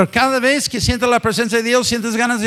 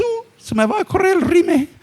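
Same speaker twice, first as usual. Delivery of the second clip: mock dainty fretting, pitched higher; woman holding back tears; 7 semitones higher than usual. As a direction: play a tearful woman worried about her makeup. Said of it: "empieza a salir la lágrima y dice". (imitando a una mujer llorosa preocupada por su maquillaje, voz aguda)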